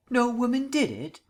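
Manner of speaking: stunned, rising outrage at the claim; a high, loud, disbelieving repeat of DID; incredulous. Acted. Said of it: "Markham's gorge was rising rapidly".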